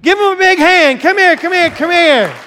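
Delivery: high, loud and eager beckoning; rally the crowd and wave him up; excited urging. Real, not acted. Pastor urging the congregation to welcome Lalo, excited and loud.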